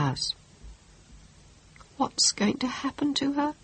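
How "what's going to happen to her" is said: high, soft moaning question; lamenting mother. Acted. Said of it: "Teresa's mother moaned".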